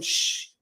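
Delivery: soft, voiceless shush; quick quiet shushing noise; imitated shush. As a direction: hush someone as in a retelling. Acts out Jimmy shushing Jay.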